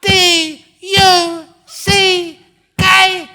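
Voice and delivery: very high, slow, deafening shout; hammed-up screaming. Husband at the peak of a silly quarrel, screaming slowly. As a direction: bellow it high, spelling out each letter.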